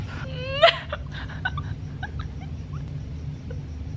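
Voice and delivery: high, soft laughing whimper; overwhelmed whimper. Real, not acted. Reader on the last two pages, overwhelmed teary whimper. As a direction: squeak between laughing and crying.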